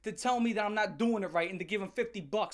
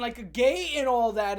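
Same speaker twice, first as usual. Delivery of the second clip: ordinary delivery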